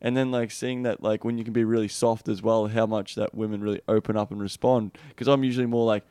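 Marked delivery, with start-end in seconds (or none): none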